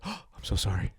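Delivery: soft, low mock apology with a gasp; soft, low mock-contrite florist; apologetic clerk.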